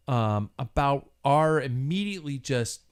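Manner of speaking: usual speaking voice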